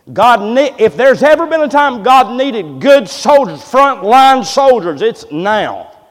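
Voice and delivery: loud, rising preacher's cry; preaching at full force. Acted. Pastor rallying his church, preaching loud and hard. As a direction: drive the point home to the congregation. Preacher calls for frontline soldiers.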